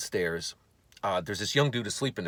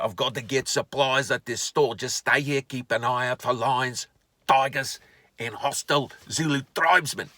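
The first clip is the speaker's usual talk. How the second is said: put-on comic voice; 3 semitones higher, 4 dB louder than usual. Husband heading to the parts store, mock safari-adventurer voice. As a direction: play the explorer warning of wild beasts. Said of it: "Shut up with the voices".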